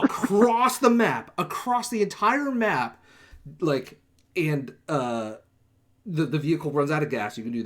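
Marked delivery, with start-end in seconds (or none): 0.0-3.0 "across" stretched slow, loud and high; exasperated emphasis. Real, not acted. Player recounting a terrible driver's long detour, exasperated emphasis. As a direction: draw out "across the entire map" with raised pitch.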